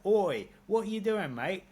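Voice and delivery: hammed-up cockney "Oi, what are you doing, mate"; working class London accent. Londoner mocks people hamming up a working class London accent, loud and high. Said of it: "lean into, like, a working class accent".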